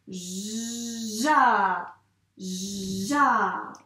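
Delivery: Ja with a long "zh" sliding into a loud, held A; blended syllable. Shown as a demonstration. Teacher blending two letters into one syllable, Ja stretched slowly. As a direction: draw Ja out, swelling on the vowel. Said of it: "on va dire le son".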